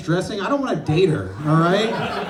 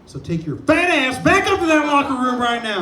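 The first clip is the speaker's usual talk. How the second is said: coach yelling; 6 semitones higher, 4 dB louder than usual. Retold or imitated. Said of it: "And he was like".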